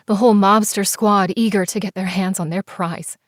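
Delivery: ordinary delivery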